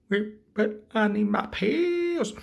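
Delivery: high, drawn-out whine for pills; high, slow, loud character voice; mock pleading. Acts out Joker pleading for pills.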